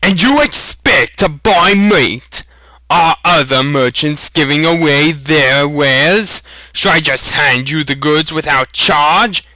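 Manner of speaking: high, loud scornful tirade; angry scolding. A narrator's reading. Butcher scolding customer without money, loud and high. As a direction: berate him with contempt.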